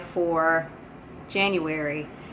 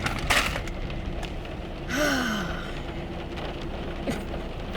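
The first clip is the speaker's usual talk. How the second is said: long weary sigh; 5 semitones higher, 8 dB quieter than usual. Real, not acted. Wrapping up a bad day.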